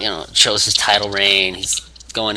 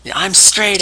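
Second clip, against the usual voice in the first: high-pitched mock promo retort; CM Punk; 10 semitones higher, slower than usual. Wrestler turning down a beer, mock defiant. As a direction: imitate a wrestler declaring his stance. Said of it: "C M Punk".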